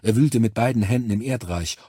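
ordinary delivery, nothing marked

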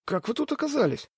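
put-on high, softened voice of a scared boy; frightened hush. A narrator's reading. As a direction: ask it high and timid, half-hushed. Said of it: "испуганно прошептал мальчишка".

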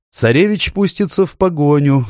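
high-pitched courtier's warning; objecting nobleman. A narrator's reading. Nobleman objecting to the escape plan, high-voiced. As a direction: warn them in a high put-on voice.

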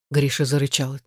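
plain reading voice

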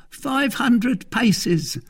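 very high, drawn-out servant report; Grimaud's character voice. Acted. Grimaud tells Athos the distance, high and slow. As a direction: stretch each word in a high voice.

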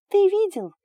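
high, loud shriek. A narrator's reading. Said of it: "визжала Далия".

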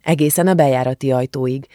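ordinary delivery, nothing marked

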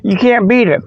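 ordinary talk

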